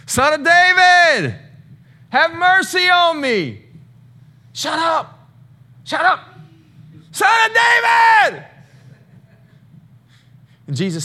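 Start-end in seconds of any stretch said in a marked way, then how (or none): 0.1-3.6 very high, loud pleading wail; mimicked beggar crying out. Preacher mimics the blind beggar's desperate high cry. 4.5-6.2 very high, mocking shush; mock crowd hushing. Preacher imitates crowd telling beggar to hush. 7.1-8.4 very high, loud shouted plea; mock desperate scream. Preacher mimics beggar screaming louder over crowd.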